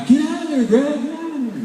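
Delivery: high-pitched mock yell at Greg; retold yelling. Superintendent yelling at the announcer to leave, high mock yell. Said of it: "kept yelling at me".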